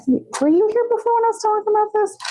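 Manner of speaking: squeaky quick question; playful high squeak. Real, not acted. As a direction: pop up high to tease the viewer. Asks chat playfully.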